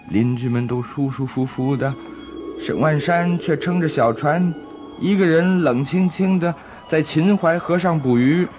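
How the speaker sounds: plain reading voice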